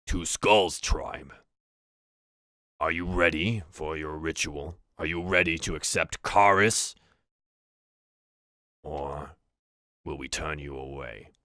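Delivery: slow, solemn orc chief; orc leader. Acted. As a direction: ceremonial challenge with long pauses.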